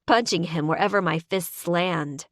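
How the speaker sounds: ordinary delivery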